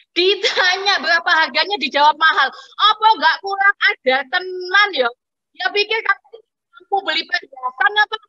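high, affronted rant; indignant outburst. Acted. Indignant reaction to snubbing shopkeeper.

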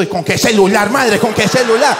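high, loud exasperated yelling; shouting at the shop lady for advice. Retold or imitated. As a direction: yell in disbelief. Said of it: "Y yo".

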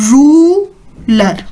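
teacher drills the word; repeat after me. Shown as a demonstration. Teacher asks kids to repeat ruler, clear model. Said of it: "Say it again".

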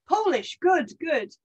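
a grinning Scots sailor greeting the Poles; Scottish accent. Retold or imitated. British sailor greets Polish soldier, light Scottish accent. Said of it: "I am doing the accent".

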